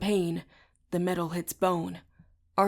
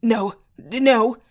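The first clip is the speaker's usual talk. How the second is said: stammering; 4 semitones higher, 9 dB louder than usual. A narrator's reading. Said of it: "Davis stammers".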